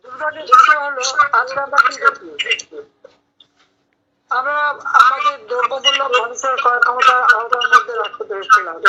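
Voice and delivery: heavy echo from the room. Real, not acted. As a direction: voice echoing on the call line. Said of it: "এখানে ইকো হচ্ছে".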